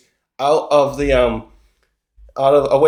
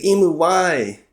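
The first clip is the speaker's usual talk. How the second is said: slow, drawn-out mock plea; retold anguished plea; 3 semitones higher, slower than usual. Dying Saturn asks Imu why, mildly hammed-up anguish. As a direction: lightly act out a betrayed servant's question.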